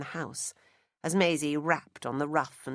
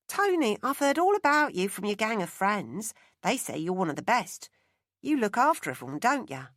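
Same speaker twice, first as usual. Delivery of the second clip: put-on Cockney accent; 5 semitones higher, 5 dB louder than usual. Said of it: "Her accent had changed".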